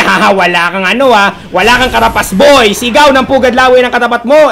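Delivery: loud, punchy mock boast; mock taunt. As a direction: caster voicing a player's taunt to a rival, loud taunting. Acting out player's taunt.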